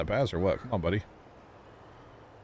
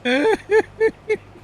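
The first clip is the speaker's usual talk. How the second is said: very high, loud giggle; real laugh; 6 dB louder than usual. Real, not acted. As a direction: burst into a high-pitched string of ha-has. Laughing at own chat banter.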